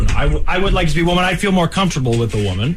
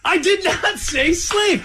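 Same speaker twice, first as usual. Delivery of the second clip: indignant denial; 11 semitones higher, much slower than usual. Real, not acted. Protesting friend's accusation.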